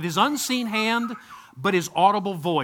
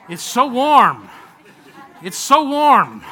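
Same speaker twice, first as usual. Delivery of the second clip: drawn-out, louder, higher "so warm"; playful hype; 5 semitones higher, 10 dB louder, slower than usual. Pretend. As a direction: sell the warm water, stretching every word. Pastor hyping the warm baptism water.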